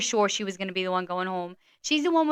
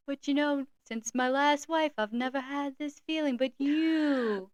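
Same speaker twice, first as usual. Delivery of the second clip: higher, slow sappy suitor; teasing imitation of tender sweet talk; Gary impression; 5 semitones higher, 4 dB quieter, slower than usual. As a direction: build a mock-heartfelt confession. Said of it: "how Gary talks".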